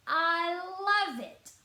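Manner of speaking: high, loud, slow drawn-out boast; gleeful announcement. A narrator's reading. Smug brother announcing he loves the rhubarb. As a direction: savour every word to rub it in. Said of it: "he announced".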